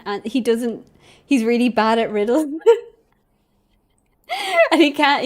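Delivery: ordinary talk, nothing marked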